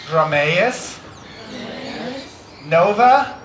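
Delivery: broken into separate words with long pauses; slow teaching delivery, one word at a time; slowly. Shown as a demonstration. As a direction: say it slowly for a crowd to repeat. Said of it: "I'll say it again more slowly".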